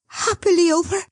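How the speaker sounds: high anxious eager question; eager cry. Acted. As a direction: Dot asking the son if it's over, high and eager. Dot asks again.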